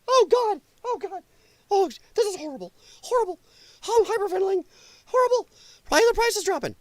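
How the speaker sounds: high, hammed-up panicking whine; mock panic. Vlogger mocking Democrats over falling prices, high hammed-up panic. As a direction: fake hyperventilating wail, high up. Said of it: "they go".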